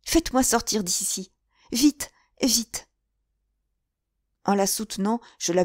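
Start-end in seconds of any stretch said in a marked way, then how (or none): 0.0-2.9 higher, urgent plea to get out; a shaken girl begging to leave; frightened young woman crying out; shivering cry. Acted. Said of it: "s'écria en frissonnant".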